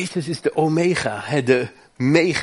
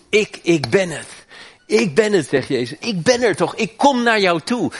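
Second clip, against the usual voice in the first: preaching Jesus' words; 7 semitones higher than usual. Acted. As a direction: proclaim to the listener. Preacher voicing Jesus comfortingly.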